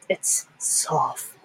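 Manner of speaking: slow, whispered correction; inner correction. Acted. Silently correcting mom in her head, slow whisper. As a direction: whisper it slowly, like a private thought. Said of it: "But in my head, I'm always like".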